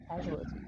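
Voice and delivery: "casualties" repeated softly and properly, CAZH-ual-tees; correct pronunciation. Shown as a demonstration. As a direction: quietly confirm "casualties", starting CAZH. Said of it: "the word is casualties".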